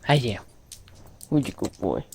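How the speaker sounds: low, soft cooing. Real, not acted. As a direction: coo sweetly at a puppy. Cooing at the dog.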